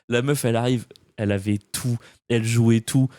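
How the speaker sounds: ordinary talk, nothing marked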